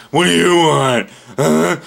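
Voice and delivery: mock rude snap. Rude imagined reply to lady.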